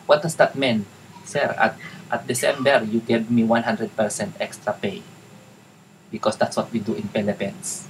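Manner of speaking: deliberately clumsy English in a Tagalog accent; botched Tagalog accent. Shown as a demonstration. Virtual assistant explaining extra December pay to client, hammed-up Tagalog accent. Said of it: "ginagawa kong palpak talaga yung Tagalog, yung English accent".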